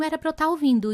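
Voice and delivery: ordinary delivery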